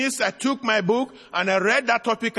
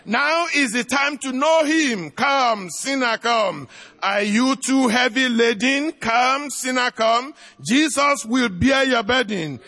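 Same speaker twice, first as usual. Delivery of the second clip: higher and slow, near a shout on the calls; fervent altar call; 4 semitones higher, slower than usual. Acted. Preacher pleading with sinners to come forward, fervent and rising. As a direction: build the hymn into a ringing plea.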